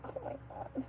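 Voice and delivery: hushed, mostly breath; whispered dismay. Retold or imitated. Reacting as Bree keeps going, whispered dismay. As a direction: whisper it as an appalled aside. Retells her appalled aside.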